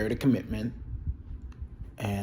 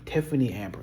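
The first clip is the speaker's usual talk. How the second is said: ordinary talk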